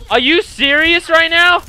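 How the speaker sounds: loud, high indignant question; exasperated gamer yell; outraged disbelief. Real, not acted. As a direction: shout it in protest, pitch held high. Disbelief at game events.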